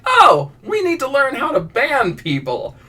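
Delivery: high, drawn-out dawning dismay; mock alarmed realization. Sudden realization acted for chat.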